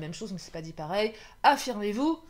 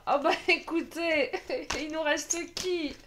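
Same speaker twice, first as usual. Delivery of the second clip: ordinary talk, nothing marked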